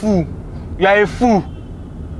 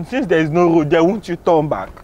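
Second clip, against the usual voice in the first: normal talking voice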